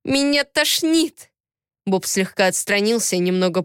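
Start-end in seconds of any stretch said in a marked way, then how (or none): 0.0-1.3 slow, high, revolted cry; woman pushing away her attacker, sickened; disgusted. A narrator's reading. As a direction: drag the words out in disgust. Heroine repulsed by Bob.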